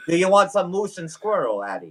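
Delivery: cartoon Russian accent. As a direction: offer it like a comic Russian villain. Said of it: "trying to hone in on my Russian accent".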